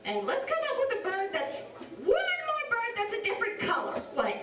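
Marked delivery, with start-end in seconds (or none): none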